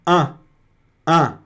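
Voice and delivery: isolated nasal vowel "an". Shown as a demonstration. Teacher shows E plus N, "an" sounded as a nasal vowel. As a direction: sound "an" alone through the nose. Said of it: "tem um som de an".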